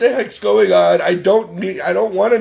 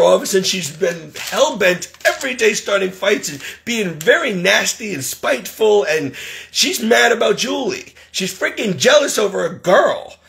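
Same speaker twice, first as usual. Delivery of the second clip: ordinary talk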